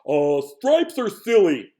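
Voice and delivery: loud, slow complaining Moose. Acted. Moose complains to the animals, loud and scoffing. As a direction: Moose scoffs loudly at the stripes. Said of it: "Moose complained".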